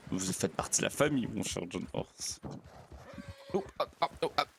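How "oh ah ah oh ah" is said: cris de surprise. Real, not acted. Chevauchée cahoteuse, aïe.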